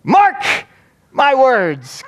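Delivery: high, loud mock pulpit cry; imitated preacher ringing out a high-pitched warning; emphatic preacher's exclamation. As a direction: shout the first word, pause, then proclaim the rest. Replays pastor's phrase in full.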